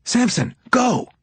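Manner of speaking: high, urgent call to a partner; sharp order in mid-struggle; urgent command. A narrator's reading. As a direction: send your partner running. Urgent order while grabbing the boy.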